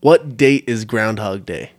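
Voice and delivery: normal talking voice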